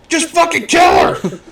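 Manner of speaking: high, loud shout at the screen; exasperated yell. Retold or imitated. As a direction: yell at the killer to get on with it. Yelling at screen in retold scene.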